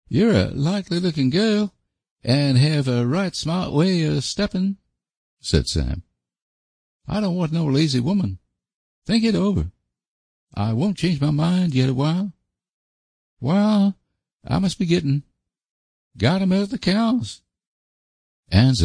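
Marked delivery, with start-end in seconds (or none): 0.1-4.8 high-pitched country fellow, earnest; awkward suitor in a raised voice; solemn rustic suitor. Acted. Voices Sam complimenting Anne. 7.1-17.4 high-pitched country fellow, earnest; awkward suitor in a raised voice; solemn rustic suitor. Acted. Voices Sam ending his proposal.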